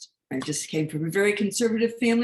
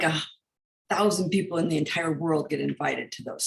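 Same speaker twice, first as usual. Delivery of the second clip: ordinary talk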